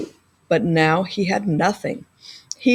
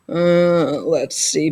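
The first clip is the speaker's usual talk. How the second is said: sleepy, yawning through the words; 4 semitones lower, much slower than usual. A narrator's reading. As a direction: say it mid-yawn. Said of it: "Bear said with a yawn".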